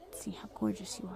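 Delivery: soft, half-whispered admiration; intimate murmur. Acted. Villain gazing at crush up close, intimate murmur.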